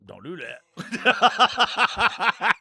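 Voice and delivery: stretched-out laughing plea; drawn-out mock plea. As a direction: drag it out, laughing. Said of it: "be like".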